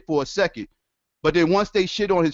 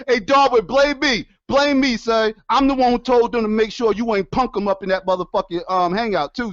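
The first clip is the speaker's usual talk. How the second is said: loud taunting callout; 6 semitones higher, 4 dB louder than usual. Real, not acted. Loudly taunting Darwin.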